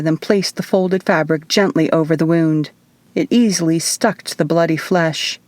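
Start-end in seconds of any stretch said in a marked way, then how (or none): none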